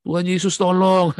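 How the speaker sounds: loud, high cry for help; retold desperate shout for help; imitating a cry. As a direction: mimic Ibu Tina calling out. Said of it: "Ibu Tina itu ya".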